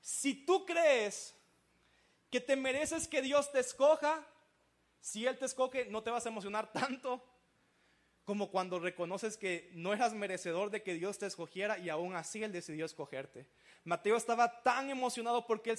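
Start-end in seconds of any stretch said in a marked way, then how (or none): none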